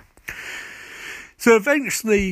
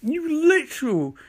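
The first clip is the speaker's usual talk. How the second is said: exasperated outburst; 10 semitones higher than usual. Retold or imitated. Venting retort at smug coworkers.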